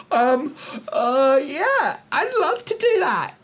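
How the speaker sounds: very high, whimpering mock-eager voice; mocking the comedian. Critic acting out the comedian accepting a gala gig, high mocking squeal. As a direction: squeal a timid yes. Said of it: "And you went".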